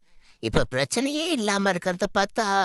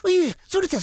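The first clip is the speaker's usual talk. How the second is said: sudden high yelp of pain; ouch, hot hot; burnt yelp; 14 semitones higher than usual. Real, not acted. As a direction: yelp as if scalded. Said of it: "என்ன சூடு?".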